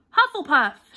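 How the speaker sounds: loud, high shouted house name; proud announcement shouted; hat shouting. A narrator's reading. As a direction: call it out to the whole hall. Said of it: "shouted the hat again".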